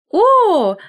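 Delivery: high loud О!; startled cry. A narrator's reading. Collar crying out under the scissors. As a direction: yelp as the scissors bite. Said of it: "вскрикнул воротничок".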